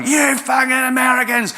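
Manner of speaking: high shouted outburst at Americans; angry cabbie. Retold or imitated. Lebanese Australian taxi driver shouting at the Scottish passenger. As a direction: yell it like a furious cab driver. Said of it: "And he let go".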